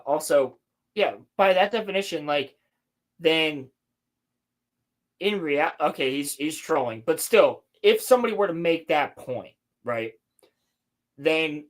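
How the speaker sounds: ordinary talk, nothing marked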